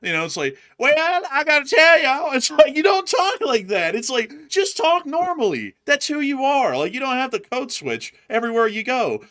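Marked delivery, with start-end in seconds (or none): 0.8-2.3 loud, high put-on Southern accent. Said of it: "like, redneck accent". 2.3-9.2 loud, high-pitched exasperation; exasperated rant. Real, not acted. Host telling a politician to talk normally, loud exasperation.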